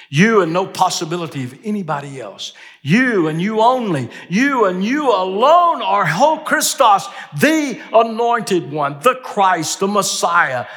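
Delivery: high, ringing stress on "You"; emphatic confession. Retold or imitated. Preacher voicing Peter's answer to Jesus, emphatic. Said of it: "he answers emphatically the same way".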